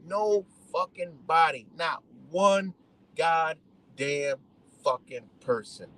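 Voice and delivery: slow, every word of "No fucking body" set apart; emphatic word-by-word. Real, not acted. Venting that nobody helped, "Not one" slow and separated. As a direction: space out each word through "goddamn fucking person".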